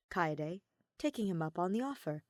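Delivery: ordinary delivery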